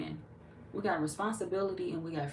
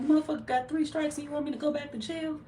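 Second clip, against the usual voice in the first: high, louder indignant quote; movie-scene quote; 10 semitones higher, 5 dB louder than usual. Retold or imitated. Retelling a Baby Boy scene, high mock-indignant. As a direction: throw it out like an outraged film character. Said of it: "He was like".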